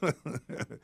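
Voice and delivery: short, high, relieved chuckle; relieved laugh. Acted. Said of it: "began to laugh".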